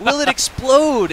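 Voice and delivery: high, loud, drawn-out worried question; anxious wondering. Retold or imitated. Voicing developers' anxious worry.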